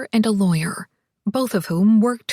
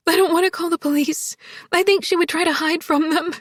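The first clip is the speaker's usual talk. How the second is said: higher, upset, tearful voice; anxious, tearful woman, pitched high; crying; 6 semitones higher, faster than usual. A narrator's reading. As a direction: talk on through crying. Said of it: "More crying".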